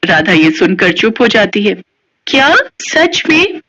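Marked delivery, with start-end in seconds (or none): 2.3-3.6 high, slow disbelieving question; can it really be true; amazed. Acted. Radha hearing the ghost will bring cake, high amazed.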